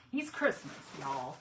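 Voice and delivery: He's Christmas in a lazy Southern drawl; playful Southern drawl for Southern friends. Shown as a demonstration. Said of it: "I put in some Southern drawl there".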